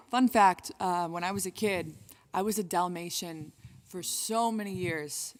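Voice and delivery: normal talking voice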